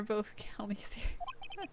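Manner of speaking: slow, whispered aside with a laugh; giggling whisper. Real, not acted. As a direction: breathe it out through a giggle. Quiet giggly aside to chat.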